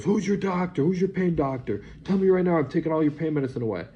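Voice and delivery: high, loud screaming demand; screaming doctor. Retold or imitated. Doctor screaming at patient about pain medicine, loud and high. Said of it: "starts screaming".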